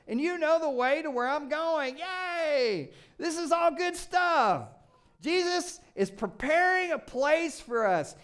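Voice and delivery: high, loud, gleeful reading with cheers; excited cheering. Acted. Preacher to congregation, cheering over the promises. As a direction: cheer each promise like great news.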